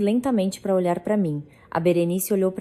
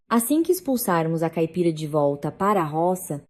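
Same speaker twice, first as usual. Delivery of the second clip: ordinary delivery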